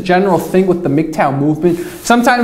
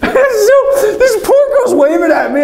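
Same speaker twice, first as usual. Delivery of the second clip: laughing; 13 semitones higher, slower than usual. Real, not acted. Laughing as he recalls the poor girl waving. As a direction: crack up while telling it. Laughing while retelling awkward moment.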